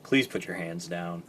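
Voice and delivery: low, soft put-on reply; weary deadpan plea. Toy character tells the excited one to stop, low weary voice. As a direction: drop it low and soft, unimpressed.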